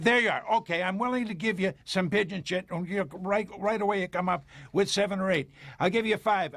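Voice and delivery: high, fast, worked-up bargaining; agitated haggling. Acted. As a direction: push the deal hard and fast. Haggling over pigeon shit.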